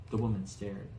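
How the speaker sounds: usual speaking voice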